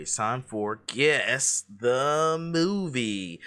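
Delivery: 1 game show announcer. Pretend. Host announces game segment.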